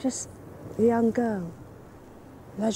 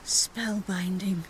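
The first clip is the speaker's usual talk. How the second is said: awed whisper; 4 semitones lower, 5 dB quieter than usual. Acted. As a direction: breathe it in awe. Woman recalls theatre show.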